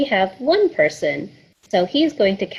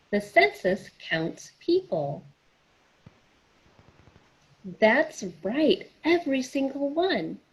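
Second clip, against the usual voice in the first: put-on rhythmic rhyming read-aloud for little kids; reading a picture book; 4 dB quieter than usual. A narrator's reading. Librarian reads a census picture book to children, story-time read-aloud. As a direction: read the rhymes to children, bouncing along each line.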